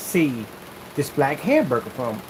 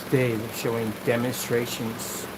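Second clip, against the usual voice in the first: low, slow monotone presenter; boring presenter voice; 5 semitones lower, 4 dB quieter than usual. Shown as a demonstration. Demoing a boring presentation voice for the camera. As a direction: drone through it like a dull demo host. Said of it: "You don't want to talk like that".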